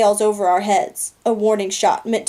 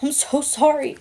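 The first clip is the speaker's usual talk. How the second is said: higher, sobbing apology; between sobs; 5 semitones higher than usual. A narrator's reading. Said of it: "I say between sobs".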